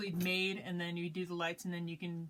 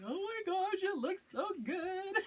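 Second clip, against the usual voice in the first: high-pitched, stretched-out squeal of delight; excited squeal; 13 semitones higher than usual. Acted. Crafter seeing the finished bag light up, squealing with excitement. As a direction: gush in a giddy, very high voice. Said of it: "you get all excited".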